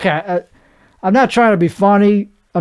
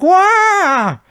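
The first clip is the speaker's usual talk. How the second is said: sudden high yelp of fright; high startled cry; freaked-out yelp; 14 semitones higher, 3 dB louder than usual. Retold or imitated. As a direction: shriek like a big bug just jumped you. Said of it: "Freaked out is like".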